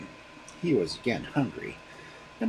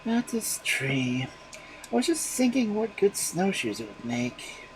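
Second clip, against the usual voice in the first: absent voice; 9 semitones higher than usual. Acted. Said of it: "in an absent voice".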